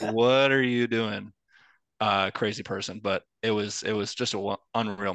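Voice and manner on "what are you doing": slow, drawn-out disbelieving question; mock bewilderment. Gym onlookers to the screaming fan, slow and incredulous. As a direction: stretch it out as if staring at a lunatic. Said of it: "They're like".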